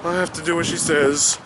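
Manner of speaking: mock henpecked husband; meek old husband. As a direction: give in timidly. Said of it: "old frail men are like".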